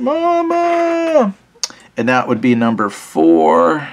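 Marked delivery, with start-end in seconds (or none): 0.0-1.3 high wailing cry for mama; scared child calling mom. Pretend. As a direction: wail like a wounded soldier. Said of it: "screaming for mom".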